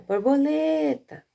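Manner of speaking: high, loud call with a long stressed LE in Borboleta. Shown as a demonstration. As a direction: call Borboleta as if it were outside. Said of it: "Você ia chamar em voz alta".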